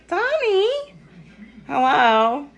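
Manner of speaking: very high, loud, squealing greeting; excited voice. Shown as a demonstration. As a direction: burst out with over-the-top excitement. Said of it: "That was my excited voice".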